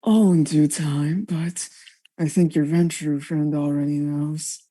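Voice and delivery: hushed sly whisper. Acted. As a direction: whisper it like a secret. Mysterious caller teases.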